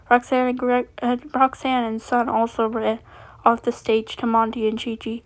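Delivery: ordinary delivery, nothing marked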